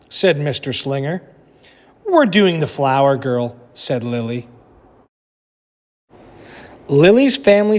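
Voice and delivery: lively storybook narration for a class; reading aloud to children. A narrator's reading. Teacher reading a picture book to her class, animated storytelling. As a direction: read it like story time for kids.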